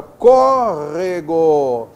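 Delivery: córrego said slowly, stress on có; slow example word. Shown as a demonstration. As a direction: spell out córrego slowly, stressing the first syllable. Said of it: "Acentuamos todas as proparoxítonas". (córrego pronunciado devagar)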